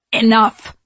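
loud, forceful single word; curt ENOUGH, hit hard; disgusted cutoff. A narrator's reading. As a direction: cut her off with disgust. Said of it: "sniffing with disgust".

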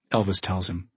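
ordinary delivery, nothing marked

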